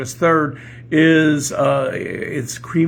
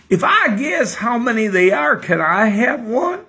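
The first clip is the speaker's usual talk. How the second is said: high, loud put-on Kentucky accent; Southern accent; 9 semitones higher, 5 dB louder than usual. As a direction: ask as a hammed-up Southern joke character. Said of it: "I'm gonna have to talk like this".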